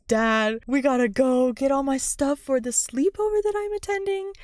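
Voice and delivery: bursting with eagerness; excited Sabrina. Retold or imitated. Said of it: "really excited and is like".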